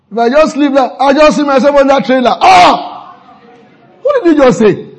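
high, loud, hammed-up panicked outcry; mock alarm. Preacher acting out careless joking about a trailer crash, mock alarm. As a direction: cry out in exaggerated dread.

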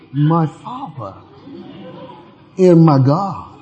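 slow, hushed, overly proper prayer; mock formal prayer voice. Preacher mimics a fussy worshipper, slow and hushed. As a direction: pray in stiff formal diction.

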